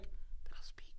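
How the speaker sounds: put-on barely audible whisper to his girlfriend; hushed, voiceless retold aside. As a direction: whisper it in disbelief.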